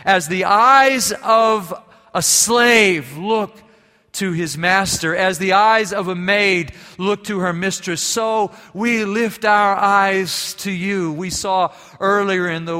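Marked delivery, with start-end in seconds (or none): 0.0-11.1 slow, louder psalm recital; reverent, drawn-out reading of the verse; solemn scripture reading. Acted. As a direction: read the psalm slowly, lifting each phrase. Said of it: "and it says".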